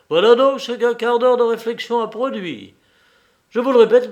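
loud, insistent character voice; indignant outburst. A narrator's reading. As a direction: throw it at him loudly. Belzoni confronts the husband.

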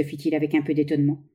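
ordinary delivery, nothing marked

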